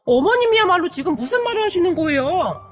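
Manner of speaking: high, indignant talking back; defiant outburst. A narrator's reading. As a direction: deny it, then turn on her heatedly. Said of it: "바락바락 대들었다고 하네요".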